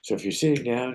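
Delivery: ordinary talk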